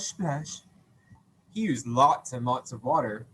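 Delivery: ordinary talk, nothing marked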